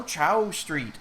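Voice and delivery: "Chow Street" with ch, rhyming with cow; imitated mispronunciation. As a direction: slowly quote her "Chow" for Shaw. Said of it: "the way she pronounced it".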